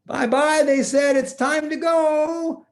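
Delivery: put-on high, slow child voice; a little child chirping goodbye; kids' goodbye.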